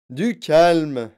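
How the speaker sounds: plus fort; crié. Acted. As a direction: lancer un ordre. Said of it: "cria monsieur Wonka". (Wonka crie pour calmer la mère)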